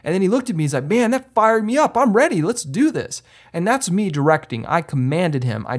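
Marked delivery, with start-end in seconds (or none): 0.8-3.2 fired up. Retold or imitated. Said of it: "and he got all excited".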